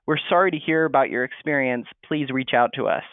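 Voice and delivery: normal talking voice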